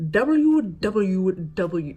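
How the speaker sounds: slow, loud, over-enunciated letters in a mock-proper voice; John McClean, over-proper. Imitating a man reading out websites, over-enunciated. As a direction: spell it out with exaggerated royal precision. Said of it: "the proper manner in which he speaks".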